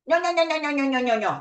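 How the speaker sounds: high, repetitive "nyoh-nyoh" babble; mocking nag noises. Mimicking a parent lecturing on and on, high mocking babble. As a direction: rattle off mock lecture gibberish.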